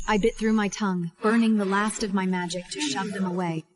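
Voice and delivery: ordinary delivery, nothing marked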